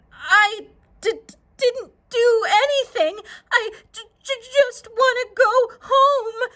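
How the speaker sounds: high, tearful stutter; frightened girl pleading to go home; scared stammer. Acted. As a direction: stammer it out near tears. Jackie stammering in fear.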